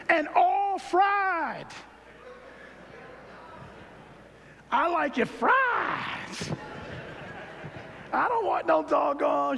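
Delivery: drawn-out, high, shouted longing for fried food; ecstatic craving. Acted. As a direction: cry it out like a preacher at the altar.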